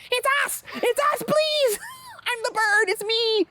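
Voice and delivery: squeaky high, shouting for help; tiny bird begging to be seen; desperate bird keeper. Retold or imitated. Keepers turned birds crying out.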